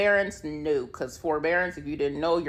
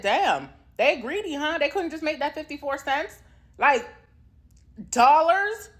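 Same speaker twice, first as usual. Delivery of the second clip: indignant mock outrage; 9 semitones higher than usual. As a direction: scoff at the greedy lenders. Indignant mocking complaint.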